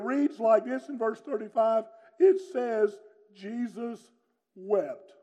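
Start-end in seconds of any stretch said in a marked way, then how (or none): none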